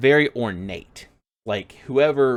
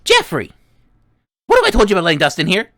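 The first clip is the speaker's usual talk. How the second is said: louder, fast high scolding; scolding gnome; 9 semitones higher, 9 dB louder, much faster than usual. Acted. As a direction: tell off the apprentice sharply. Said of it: "And he's like".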